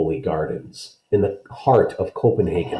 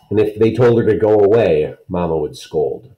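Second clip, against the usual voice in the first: ordinary delivery, nothing marked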